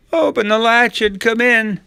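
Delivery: very high, loud fake old-lady voice; imitating quavering grandma. Wolf in bed imitates Grandma, quavering little voice. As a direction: put on a little grandma falsetto. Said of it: "Trying to imitate Grandma's quavering little voice".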